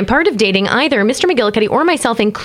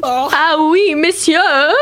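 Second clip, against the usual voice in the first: put-on French accent; 6 semitones higher, much slower than usual. Echoes in mock French.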